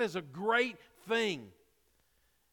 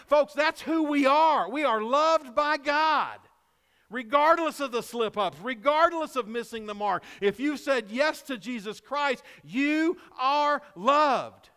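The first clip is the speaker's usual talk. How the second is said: slow, loud, higher preaching; preaching at full force; 5 semitones higher, 5 dB louder than usual. Acted. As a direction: proclaim it slowly to the congregation.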